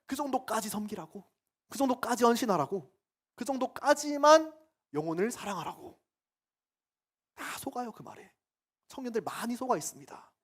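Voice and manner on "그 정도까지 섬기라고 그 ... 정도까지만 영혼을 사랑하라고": rising louder and higher each phrase; building emphasis. Real, not acted. Preacher mocks the limits of service, building to a loud high peak.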